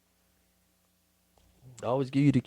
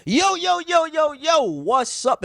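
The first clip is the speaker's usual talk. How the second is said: hype intro; 12 semitones higher, 5 dB louder, much slower than usual. Real, not acted. Podcast host opens the episode.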